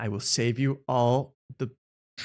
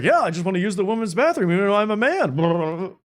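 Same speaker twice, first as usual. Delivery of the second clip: Jeff Dye impression; 6 semitones higher than usual. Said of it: "he's like".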